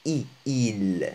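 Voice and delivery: "il" with a close ee vowel; kasra vowel. Shown as a demonstration. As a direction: isolate the ee, then say "il" with it. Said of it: "هنا تكون بالكسرة".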